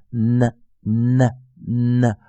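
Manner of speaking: "呢" as a held N released into a schwa; isolated, drawn-out N sound on "呢"; stressed dark N. Shown as a demonstration. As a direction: model "呢" as a heavy "nnn-uh". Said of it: "把这个schwa 这个中央元音呃".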